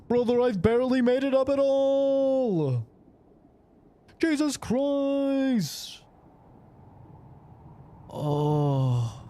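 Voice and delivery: high, strained exhausted voice; strained and winded. Real, not acted. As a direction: squeeze it out, barely holding on. Exhausted after the climb.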